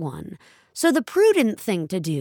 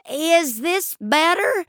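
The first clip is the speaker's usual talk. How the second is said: raised voice; 10 semitones higher than usual. A narrator's reading. Old Ellen speaking up to the teller, raised and slow. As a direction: speak up for a bad connection. Ellen raising her voice on phone.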